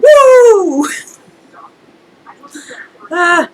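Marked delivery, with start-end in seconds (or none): 0.0-0.9 long, high, loud whoop; loud celebratory howl; Ric Flair woo. Acted. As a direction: let out a wrestler's drawn-out "woo". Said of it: "do a Ric Flair and go".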